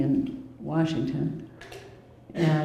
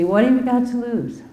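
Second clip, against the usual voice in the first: challenging question; 7 semitones higher, 5 dB louder than usual. Retold or imitated. Brother's challenge, retold.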